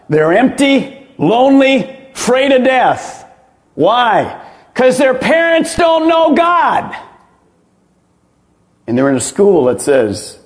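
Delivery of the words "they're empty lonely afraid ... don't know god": high, drawn-out preacher's cry; emphatic preaching. Real, not acted. Preacher telling the congregation why kids take drugs, emphatic preaching. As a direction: build slowly higher, landing each phrase hard. Preacher driving home his answer.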